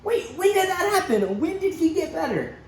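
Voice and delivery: hardly able to wait for the answer; eager amazement. Acted. Father asking servants when his son recovered, eager.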